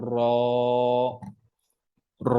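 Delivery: "র" as heavy Arabic raa, held long; long full-mouthed "র"; thick, full "র". Shown as a demonstration. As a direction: round the mouth and draw out a full "র". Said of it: "পুর হচ্ছে মোটা করে".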